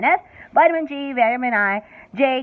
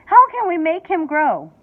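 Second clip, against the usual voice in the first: higher and louder wail; mother wailing to Wonka for help; 5 semitones higher, 6 dB louder than usual. A narrator's reading. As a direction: plead in distress. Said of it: "wailed Missus T V".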